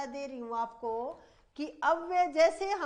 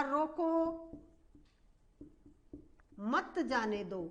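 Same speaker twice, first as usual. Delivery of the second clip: रोको drawn out, long pause, then slow मत जाने दो; stretched रोको standing apart from मत जाने दो; stress on रोको; slower than usual. Shown as a demonstration. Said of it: "ये बलाघात जहां होता है".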